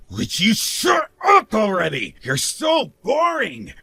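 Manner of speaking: high, exasperated outburst; exasperated shout. Acted. Husband silencing his complaining wife, exasperated shout. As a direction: shout her down, drawing out the insult.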